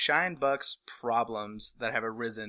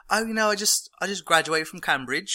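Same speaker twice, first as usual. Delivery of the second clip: put-on higher-pitched casual British accent; 6 semitones higher than usual. Barista fibbing to a cute girl, fake British accent. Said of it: "in a British accent".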